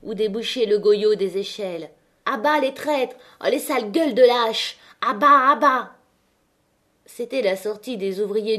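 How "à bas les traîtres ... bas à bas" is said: raised, high-pitched crowd booing; jeering. A narrator's reading. Strikers at the workers leaving the pit, jeering. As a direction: hurl it as an angry mob chant. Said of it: "des huées s'élevèrent dehors".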